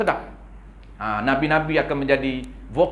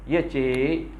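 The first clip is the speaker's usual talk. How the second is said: coquettish operator. Retold or imitated. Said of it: "Oh, dia main lenggok".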